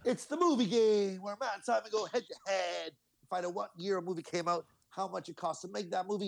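high, bright game show intro; announcer voice. Acted. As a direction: sell it like a game show host.

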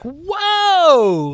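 high, drawn-out mock alarm; exaggerated retold shock; startled protest. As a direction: stretch it high to halt the accusation. Retold startled reaction to accusation.

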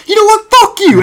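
loud, very high mock outburst; hammed-up high yell; imitated angry gamer. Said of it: "he starts getting so angry".

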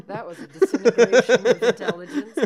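loud, through real laughter; laughing hard. Real, not acted. Reacting to the silly wanker wordplay, laughing hard.

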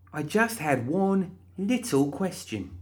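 high, slow sing-song; slow, high mock-innocent lilt. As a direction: plead innocence sweetly. Molly complaining.

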